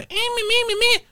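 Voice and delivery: very high squeaky anime whine; put-on anime voice. Describing her character's put-on voice in the documentary, very high and squeaky. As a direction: squeak it like a cartoon girl. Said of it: "the put on voice".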